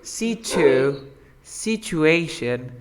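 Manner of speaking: situation slowly, with CH in si-chu-ation; correct pronunciation modelled. Shown as a demonstration. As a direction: break off situ- as si-chu, then the whole word. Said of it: "How do we say situación?".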